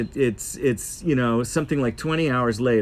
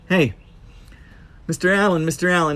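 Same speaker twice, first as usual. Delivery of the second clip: higher-pitched imitation of a Syrian accent; imitated Syrian accent; 6 semitones higher, faster than usual. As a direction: call out in a put-on Syrian accent. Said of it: "pardon the accent".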